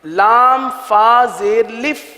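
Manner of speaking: slow drawn-out letter naming; a slow, stretched wrong-style reading. Shown as a demonstration. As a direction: spell it out slowly, letter by letter. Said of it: "اب اس کو الف پڑھنا".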